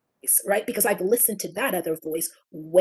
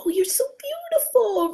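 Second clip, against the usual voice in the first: much higher, louder sweet cooing; cooing at baby; 3 dB louder than usual. Retold or imitated. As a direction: gush over the baby. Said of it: "where I go".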